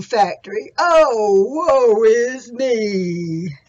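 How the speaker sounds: loud, drawn-out lament; loud, slow donkey voice wailing woe is me; woeful old donkey. Acted. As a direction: moan your sorrows like a worn-out donkey.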